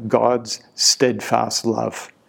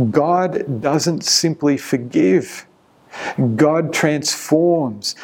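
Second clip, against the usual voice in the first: slow, rising emphasis on transforms; slow emphatic preaching; 3 semitones higher, slower than usual. Real, not acted. Preacher to congregation, slow emphatic climax. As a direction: lean into it slowly.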